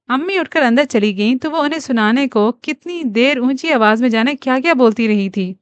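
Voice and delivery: ordinary delivery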